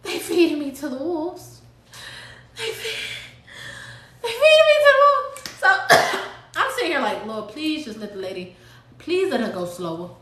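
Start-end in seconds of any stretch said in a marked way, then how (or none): 0.0-5.6 mock panic. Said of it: "And I'm like". 7.2-10.1 soft, low pleading prayer; hushed prayer. Retold or imitated. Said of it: "asking the Lord".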